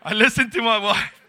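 high, loud comic confession; blurted excuse. Retold or imitated. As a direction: blurt it out as a hapless husband's excuse.

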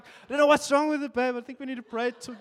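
high, loud put-on fretting voice; mock alarm, squeaky and loud; feigned worry. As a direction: ham up fake concern to keep her praying. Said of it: "I was just like".

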